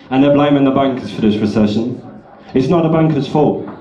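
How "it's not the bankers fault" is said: ordinary talk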